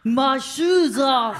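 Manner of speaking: loud, drawn-out fake French accent; mock French accent. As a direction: ham up a bad French accent, high and slow. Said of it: "I could do an accent".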